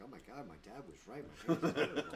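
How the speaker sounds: laughing at own hair; half-laughing admission. Retold or imitated. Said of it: "I'm like".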